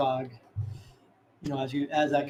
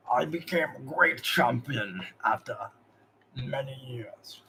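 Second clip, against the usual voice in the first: lightly voiced orc master. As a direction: tell it like a proud old orc. Zog continues his tale.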